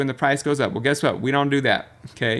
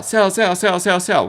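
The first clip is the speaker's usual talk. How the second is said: mimicking panic sellers; 7 semitones higher, 4 dB louder than usual.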